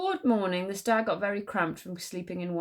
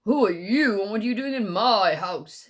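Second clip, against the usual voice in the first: deepest voice; 7 dB louder than usual. Acted. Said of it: "in his deepest voice".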